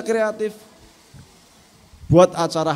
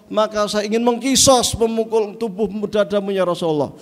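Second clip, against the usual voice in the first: companion's grievance. Acted. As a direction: press the old grievance boldly.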